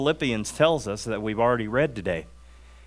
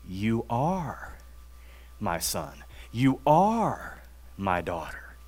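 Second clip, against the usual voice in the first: soft, slow and lower, each word placed gently; tender voice of God; 4 semitones lower, 8 dB quieter, slower than usual. Retold or imitated. God telling every sinner they are his son or daughter, tender and hushed. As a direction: speak it like a loving father to his child.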